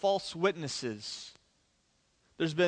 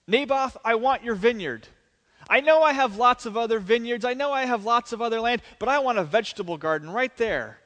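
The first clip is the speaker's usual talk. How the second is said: higher, louder spoiled whine; king whining to Naboth like a spoiled brat, higher and louder; Ahab as Veruca Salt; 5 semitones higher, 4 dB louder than usual. Retold or imitated. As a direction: whine like a spoiled child demanding a toy. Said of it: "Ahab is playing Veruca Salt".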